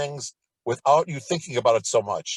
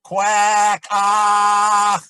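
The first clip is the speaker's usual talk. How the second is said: obnoxious piercing quack; 6 semitones higher, 8 dB louder than usual. Shown as a demonstration. Teacher demonstrating the obnoxious quack drill, piercing and loud. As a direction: Quack ah much higher and louder, piercing. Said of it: "it sounds obnoxious".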